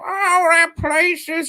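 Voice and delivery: high, meowing cat-like voice; cat lady voice. Retold or imitated. Cat lady answering the boy, high and catlike. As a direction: squeak it out like a cat. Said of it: "she sounds like a cat".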